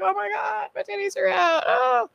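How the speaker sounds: squeaky high-pitched mock panic; high mock alarm. A character flustered her clothes fell off, high mock panic. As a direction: shriek it up high like a flustered girl. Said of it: "It's just like".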